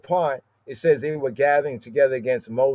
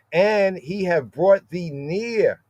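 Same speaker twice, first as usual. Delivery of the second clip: slow and raised, leaning hard on "near"; stress on near; 4 semitones higher, slower than usual. Shown as a demonstration. Preacher reading the verse to his flock, stressing "near". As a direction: draw out the verse and land heavily on "near". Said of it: "Emphasize that nearness".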